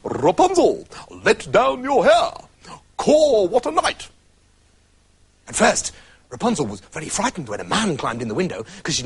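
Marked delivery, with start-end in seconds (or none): 0.0-4.1 calling up. Acted. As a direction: call out to the window above. Prince calling up the tower.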